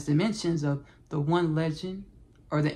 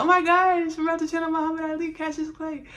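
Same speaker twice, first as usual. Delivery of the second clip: high, fast giddy gush; excited squeal; 10 semitones higher than usual. Retold or imitated. Host retelling her thrill at channeling Ali, high giddy gush. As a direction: gush like a starstruck fan. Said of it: "I just started smiling".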